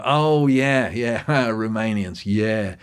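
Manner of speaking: mock knowing agreement with a laugh; imagined knowing agreement. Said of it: "he can assume that that person will go".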